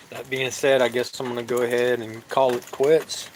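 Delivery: ordinary talk, nothing marked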